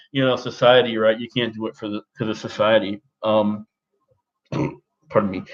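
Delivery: ordinary delivery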